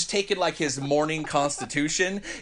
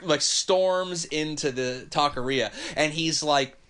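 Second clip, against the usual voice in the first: ordinary talk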